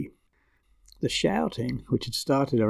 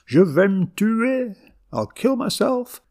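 frenzied threat; 10 semitones higher than usual. A narrator's reading. Grandmother threatening her grandchildren, frenzied high cry. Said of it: "Now we're shouting too".

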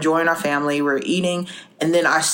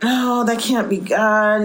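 slow, high, drawn-out mock denial; second-guessing doubt; 5 semitones higher, slower than usual. Woman talking herself out of God's prompting, acted slow, high doubt. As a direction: stretch it out in doubtful protest. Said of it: "I'm second guessing myself".

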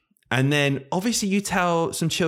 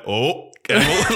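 laughing teasing; 3 semitones higher, 4 dB louder, slower than usual. Retold or imitated. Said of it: "I went".

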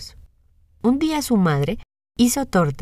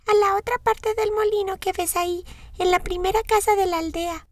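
voz aguda e infantil de niña; 13 semitones higher than usual. A narrator's reading. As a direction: una niña inocente, alta y dulce. Voz de Caperucita. (voz de niña pequeña)

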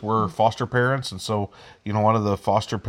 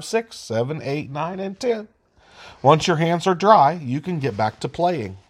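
put-on higher, lively reading for little kids; reading to children; 5 semitones higher than usual. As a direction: read it out like to small children. Reads infographic to kids.

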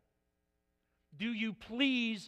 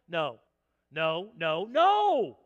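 parent refusing; 4 semitones lower, 7 dB louder, much slower than usual. Retold or imitated. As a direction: hold out against the nagging. Parent refusing the nagging child.

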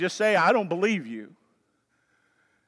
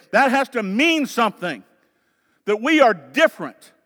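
raised, loud, emphatic sermon exhortation; impassioned preaching; 5 semitones higher, 8 dB louder than usual. Acted. Pastor calling the congregation to be different, impassioned preaching. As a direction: press the call to holiness on them.

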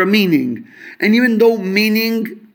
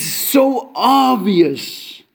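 drawn-out emphasis; 2 semitones higher, much slower than usual. Real, not acted. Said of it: "stretches the word for emphasis".

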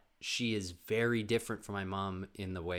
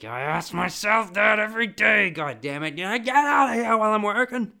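much higher, much louder fed-up yell; the grandfather venting his frustration at full voice; exasperated grandfather; 13 semitones higher, 15 dB louder than usual. Retold or imitated. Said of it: "And he was like".

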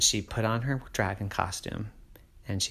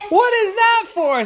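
Mommy's voice; 9 dB louder than usual. Acted. Mommy voiced in bedtime story.

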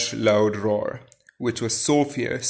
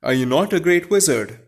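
higher, louder incredulous question; astonished cry; 6 semitones higher, 6 dB louder than usual. A narrator's reading. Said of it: "cried Dorothy".

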